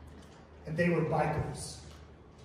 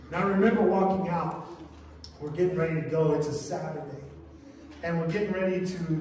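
ordinary delivery

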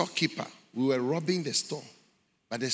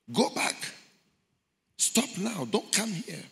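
high, pleading cry; urgent warning; 9 semitones higher than usual. Retold or imitated. Voices in hell urging him back, pleading. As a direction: beg him to turn back.

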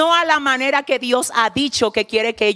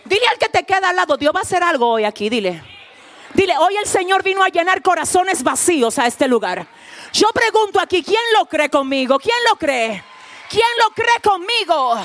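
preaching at full force; 5 semitones higher than usual. Acted. Preacher rousing the congregation to answer, shouting. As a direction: fire up the congregation.

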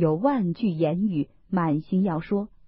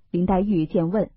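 ordinary delivery

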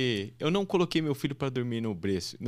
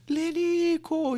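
very high, loud, drawn-out name; mock sportscaster yell; football commentator's goal call; 6 dB louder, much slower than usual. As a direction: imitate a commentator stretching out the scorer's name. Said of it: "E aí o narrador".

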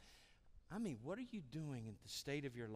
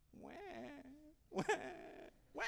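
mock crying. Mocking Elijah's whining.

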